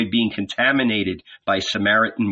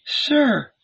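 raised pitch, gentle and pleading; woman's voice; 11 semitones higher than usual. Acted. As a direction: speak as the Samaritan woman.

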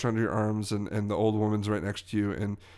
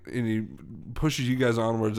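normal talking voice

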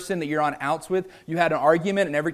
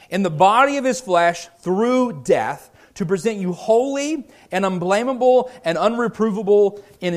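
louder, raised Bible reading from the pulpit; scripture reading; 3 semitones higher, 4 dB louder than usual. Acted. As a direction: proclaim the verses to the congregation. Reads Colossians aloud.

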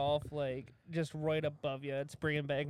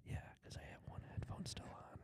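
whispering; 17 dB quieter than usual. Real, not acted. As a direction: close mic whisper. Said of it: "I can whisper to you".

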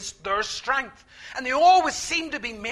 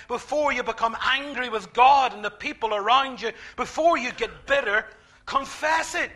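ordinary talk, nothing marked

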